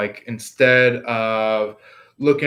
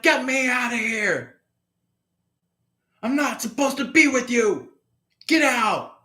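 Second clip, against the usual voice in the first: panicked plea; 10 semitones higher than usual. Retold or imitated. Captive pleading in tarot story.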